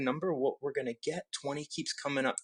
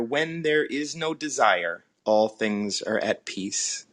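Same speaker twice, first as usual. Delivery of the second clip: slow reading; 3 semitones lower, 7 dB louder, slower than usual. Acted. Reading Tao verse aloud.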